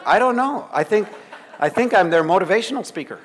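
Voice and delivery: amused, half laughing reply; laughing while answering. Retold or imitated. Laughing at own odd answer.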